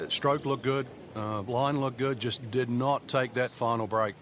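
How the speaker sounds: ordinary talk, nothing marked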